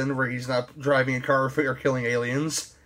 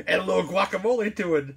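Luis Guzman quote; 7 semitones higher than usual. Retold or imitated. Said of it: "What's Luis Guzman say".

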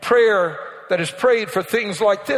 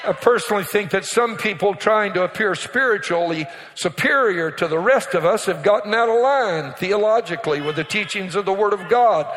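ordinary delivery

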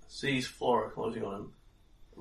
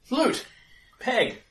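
high, loud rallying shout; urgent call to arms; shouted orders; 9 semitones higher, 5 dB louder, slower than usual. Acted. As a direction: bellow orders to your gang, pitched up. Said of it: "he shouts out".

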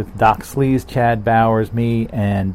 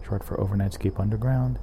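ordinary talk, nothing marked

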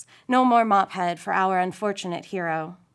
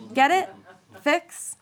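chuckling; 6 semitones higher, slower than usual. A narrator's reading. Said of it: "he chuckles".

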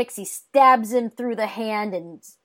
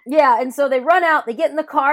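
ordinary talk

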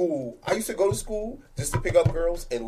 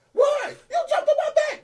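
high protest. Retold or imitated.

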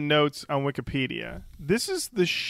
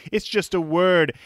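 ordinary talk, nothing marked

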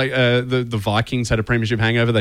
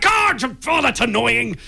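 high-pitched, exaggerated exasperation; hammed-up frustrated fan; mock annoyance; 13 semitones higher, slower than usual. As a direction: whine it high, at no great volume. Contrasting reaction to women players, acted.

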